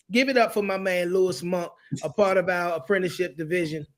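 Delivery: usual speaking voice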